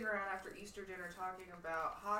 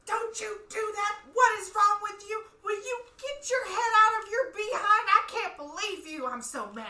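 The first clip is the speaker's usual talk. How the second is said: high, loud, scolding yell at the players; grandma yelling at the rink; hockey fan yelling; 11 semitones higher, 10 dB louder than usual. Retold or imitated. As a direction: holler from the stands like an outraged grandma. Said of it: "She just".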